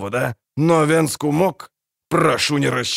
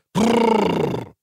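high rolled prrr to halt horses; whoa to horses; 10 semitones higher than usual. Acted. Dwarf trills at the horses to halt them.

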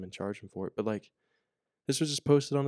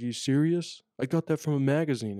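higher, louder mock outrage; angry inmate; 5 semitones higher, 5 dB louder than usual. Angry inmate protesting his confiscated picture, in a higher, louder voice. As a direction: play the inmate protesting, half-shouting. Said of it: "screaming at me".

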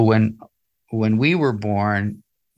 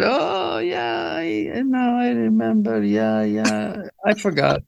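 much higher, airy mother voice, breezily admitting she forgot; imitating his mother; 14 semitones higher, slower than usual. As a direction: play the flustered mum, pitched way up. Son voices his mother's reply.

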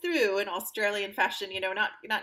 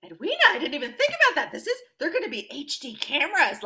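excited; 7 semitones higher, 9 dB louder, faster than usual. Retold or imitated. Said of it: "And I'm like".

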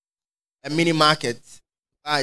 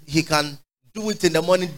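normal talking voice

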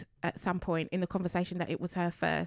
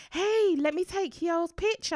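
photographer; 10 semitones higher than usual. Retold or imitated. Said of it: "the photographer was like".

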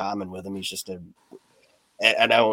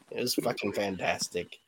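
ordinary delivery

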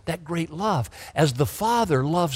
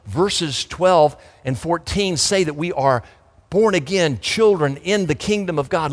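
ordinary delivery

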